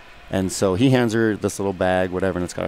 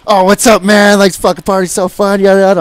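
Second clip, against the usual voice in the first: loud, high-pitched mock party greeting; all happy; 12 semitones higher, 11 dB louder than usual. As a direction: imitate an overjoyed buddy shouting hello. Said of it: "comes over all happy".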